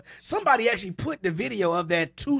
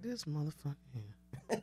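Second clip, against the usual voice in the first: put-on grumbling old senator; 5 semitones lower, 16 dB quieter, slower than usual. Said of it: "McConnell was sitting there looking like".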